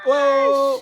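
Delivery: loud, high cheer; excited whoa. Real, not acted. Welcoming the guest to the stream with a loud high whoop. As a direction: whoop it out big.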